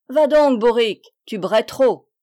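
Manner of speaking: angry shout. A narrator's reading. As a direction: shout her out of the door. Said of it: "la jeta dehors en criant".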